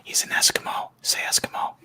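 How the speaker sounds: soft, voiceless whisper; whispered prompt. Pretend. Feeding a friend a joke answer to the accent quiz, whispered.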